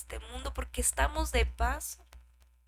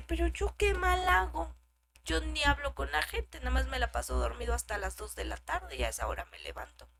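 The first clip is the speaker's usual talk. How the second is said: higher, louder whiny self-justifying voice; mock excuse; 4 semitones higher, 5 dB louder than usual. Preacher mimicking a churchgoer excusing his sleeping in. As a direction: play the lazy believer brushing it off. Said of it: "podemos decir".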